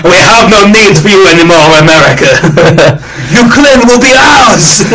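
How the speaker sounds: mock villain boast. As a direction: gloat like a cartoon villain. Mock villain speech of Putin.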